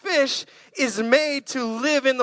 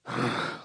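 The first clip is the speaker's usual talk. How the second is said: long sinking sigh; a lion giving up with a heavy sigh; deflated sigh; 8 semitones lower, 10 dB quieter than usual. Pretend. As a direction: let the air out of a beaten lion.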